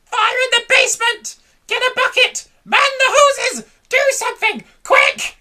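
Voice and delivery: high, loud barked commands; frantic orders. A narrator's reading. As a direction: yell orders at everyone. Grandma yelling orders in panic.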